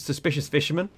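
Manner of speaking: normal talking voice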